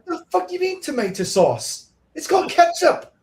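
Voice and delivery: very high, squeaky outrage; high-pitched hammed-up protest; mock indignation. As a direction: squawk it like an indignant kid. Mock baffled kid's outburst about slang.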